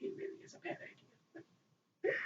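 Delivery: breathy conspiratorial aside; hushed warning. Pretend. Fan ending the warning to Usagi in a whisper. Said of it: "whispered warning end".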